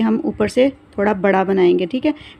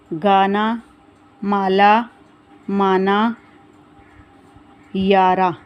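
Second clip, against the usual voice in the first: reading words aloud; much slower than usual. Shown as a demonstration. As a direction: clear word-by-word reading for children. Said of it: "मैं आपको पढ़ के बताती हूँ". (बच्चों को शब्द पढ़कर सुनाना)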